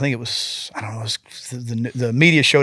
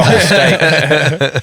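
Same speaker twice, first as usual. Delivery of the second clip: slow, louder, laughing through the words; amused disbelief at the dog; words stretched by laughter; 3 semitones higher, 7 dB louder, much slower than usual. Real, not acted.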